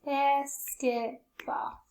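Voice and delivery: BASKETBALL in three slow, separate beats; syllable by syllable. Shown as a demonstration. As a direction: count out BASKETBALL as "bas, ket, ball". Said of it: "How many times?".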